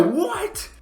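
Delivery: very high, stretched disbelieving cry; shocked. Real, not acted. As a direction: gasp it out, stunned. Shock at opponent's shot.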